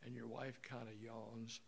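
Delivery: ordinary talk, nothing marked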